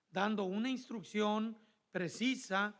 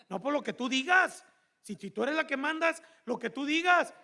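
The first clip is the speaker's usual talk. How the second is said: louder, higher mocking echo of her words; heated marital spat, higher and louder; sarcastic retort; 5 semitones higher, 8 dB louder than usual. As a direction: throw her words back in a rising quarrel. Couple's argument, sarcastic retort.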